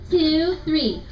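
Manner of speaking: plain reading voice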